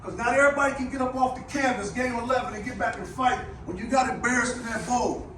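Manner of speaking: loud rousing locker-room speech; halftime pep speech. Real, not acted. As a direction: fire up the team, rising to a shout. Building pep speech to players.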